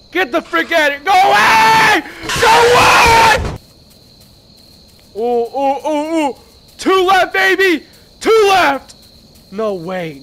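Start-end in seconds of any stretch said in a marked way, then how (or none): 0.1-3.6 very loud high scream; panicked shout. Real, not acted. Chased by the woman in the game, panicked screaming. 6.8-8.9 loud high cheer; excited shout. Real, not acted. Finding papers in the game, excited loud cheer.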